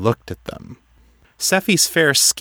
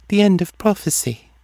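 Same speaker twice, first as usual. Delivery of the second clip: high, detached oracle voice; distant; 11 semitones higher than usual. A narrator's reading. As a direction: pitch it high and far away, as if dreaming.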